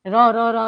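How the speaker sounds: "ર" rolled with a trilled tongue; trilled r demo. Shown as a demonstration. Teacher shows students how the trill sounds, rolled "ર". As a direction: roll the "ર" like a continuous trill. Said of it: "ર, continuously જે પ્રકંપી છે".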